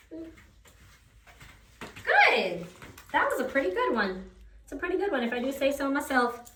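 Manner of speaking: high drawn-out praise; proud high-pitched praise; pleased praise. Real, not acted. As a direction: stretch the good out, delighted. Praising dog's turn.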